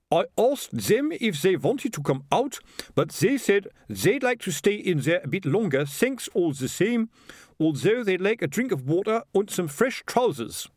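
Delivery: lightly voiced proud sergeant; a hint of a pleased watchman; beaming sergeant. Cheerful sergeant reports.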